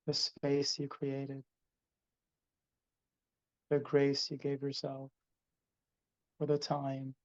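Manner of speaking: ordinary talk, nothing marked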